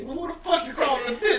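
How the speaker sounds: hammed-up high-pitched outrage; mock offended woman. Woman snapping back at his greeting, mock offended.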